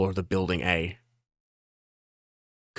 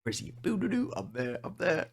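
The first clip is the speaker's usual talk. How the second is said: high little tune; playful singsong; 10 semitones higher, 7 dB quieter, faster than usual. Real, not acted. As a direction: sing it, bouncing along. Playful searching for Luffy.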